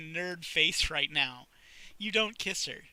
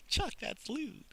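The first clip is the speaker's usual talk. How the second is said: higher giggly mock scold; giggling; 10 semitones higher, 3 dB quieter, slower than usual. Sally laughing off Chuck's joke, higher.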